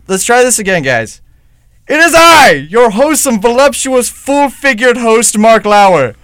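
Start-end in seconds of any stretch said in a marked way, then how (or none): 1.9-6.1 booming, high and loud host fanfare; grandiose self-introduction. Acted. Host retakes the botched intro as himself, bombastic announcer voice. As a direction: proclaim yourself with drawn-out relish.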